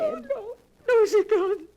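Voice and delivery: crying out loud in grief; loud, breaking protest of grief; tearful denial. Acted. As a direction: sob out the denial. Cat has just died.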